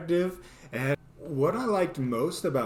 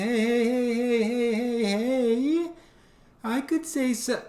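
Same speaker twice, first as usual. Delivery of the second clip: much higher, slow indignant whine; high whiny protest; 9 semitones higher than usual. Retold or imitated. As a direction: whine like an offended kid. Protesting inner voice.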